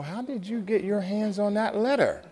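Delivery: high-pitched imitation of a woman demanding answers; put-on woman's voice, climbing high; indignant woman's voice. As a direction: rise into a high, insistent question. Woman voiced, indignant then puzzled.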